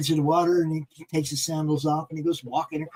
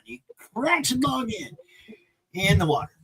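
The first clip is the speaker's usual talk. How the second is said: ordinary delivery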